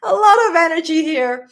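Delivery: tearful, with a crack of real crying; fighting back real tears while talking; teary. Real, not acted. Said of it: "I am really getting emotional".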